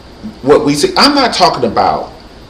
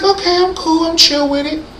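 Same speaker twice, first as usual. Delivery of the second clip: playing cool Oprah. Oprah saying she's chill until pissed off, high mock-cool voice. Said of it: "because she's like".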